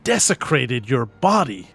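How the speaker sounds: loud, slow, stately appeal to a ghost; impassioned address. Acted. To the ghost Oscar over his bones, loud impassioned plea. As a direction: proclaim it to the spirit, full voice.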